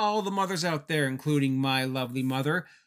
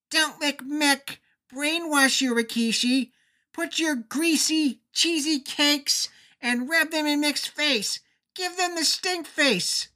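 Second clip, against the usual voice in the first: mock Stephanie; 13 semitones higher, 3 dB louder than usual. Stephanie yelling to Rikishi.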